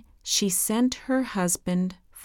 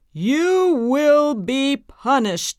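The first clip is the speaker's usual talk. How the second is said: loud, slow, menacing witch voice; angry witch; 6 semitones higher, 5 dB louder, slower than usual. A narrator's reading. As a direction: pronounce the sentence like a vengeful witch. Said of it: "said the witch angrily".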